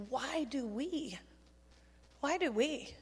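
a quiet, searching question put to the room; hushed appeal. Real, not acted. Preacher quietly challenges adults about limiting kids, hushed appeal. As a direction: drop to a soft, slow question. Pointed question, dropped to hush.

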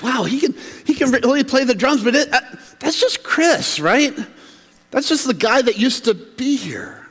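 high-pitched, impressed then dismissive; marvel, then write him off as familiar; acted incredulous amazement. Locals watching Chris back from tour, incredulous.

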